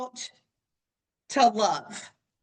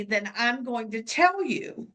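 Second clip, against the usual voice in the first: ordinary talk, nothing marked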